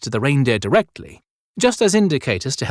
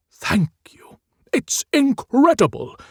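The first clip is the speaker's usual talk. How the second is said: breathless whisper of wonder; awed whisper; 13 semitones higher than usual. Acted. Santa thanking Shelley for the armoured coat, whispered. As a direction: whisper it, amazed. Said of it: "he'd never expected anything like this".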